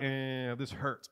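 low, soft mock moan of pain; imitated sore, sulky complaint; grumbling. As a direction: grumble it under a groan. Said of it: "I still was grumbling".

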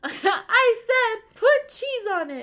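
high, loud, slow nagging granny; hammed-up bickering grandma; cranky old lady. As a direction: scold like an old woman at dinner. Imitating elderly friend nagging.

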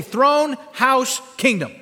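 loud, high words for the crowd to repeat; leading a call-out. Shown as a demonstration. Preacher gets everybody to say the three words, loud and punchy. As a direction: belt out each word separately. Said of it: "Everybody say".

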